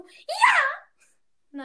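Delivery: squeaky high-pitched yelled "ja". Retold or imitated. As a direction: shriek it in a high girly voice. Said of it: "du musst jetzt auch immer so mit hoher Stimme so".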